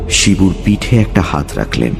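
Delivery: ordinary delivery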